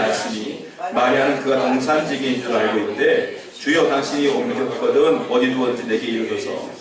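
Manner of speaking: 낮은 목소리로 또박또박 성경 봉독; scripture reading. Acted. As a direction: read the verses low and measured, like a lector. (성경을 낮고 차분하게 낭독)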